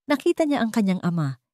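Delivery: ordinary delivery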